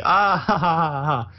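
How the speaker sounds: mock laugh. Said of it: "[name] laughs to himself".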